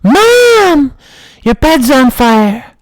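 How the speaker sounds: put-on very high, loud child's cry for help; high panicked kid yelling mom. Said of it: "I yelled to my mom".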